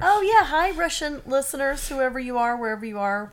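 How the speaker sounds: high, friendly "Hi, Russian listeners"; cheerful greeting. Real, not acted. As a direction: wave hello to faraway listeners.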